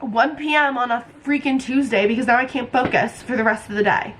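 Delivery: usual speaking voice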